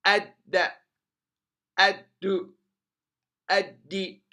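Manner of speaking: "الد" as ad-da, ad-du, ad-di, no l; doubled dal. Shown as a demonstration. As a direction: hit the double d of "الد" before each vowel. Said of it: "مشدد". (نطق "الد" بدال مشددة بالحركات الثلاث)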